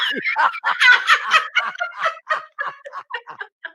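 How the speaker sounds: high shrieking laugh. Real, not acted. Comedian cracking up at his own nun joke. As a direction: howl at the punchline.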